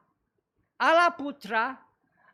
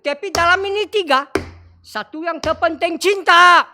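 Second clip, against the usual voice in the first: louder, faster, building to the key word; rising emphatic preaching; 6 semitones higher, 8 dB louder than usual. Real, not acted. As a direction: drive it home, love matters most. (khotbah makin lantang dan cepat)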